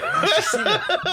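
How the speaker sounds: high, loud laughing put-on voice; mock greeting. Teller mocking a singer greeting a friend, high and loud. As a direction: squeal the greeting through a laugh.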